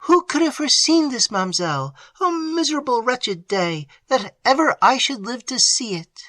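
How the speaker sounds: high, wailing lament of a maid. A narrator's reading. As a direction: moan it out in grief. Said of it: "she continued to moan and lament".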